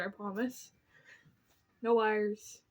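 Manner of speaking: usual speaking voice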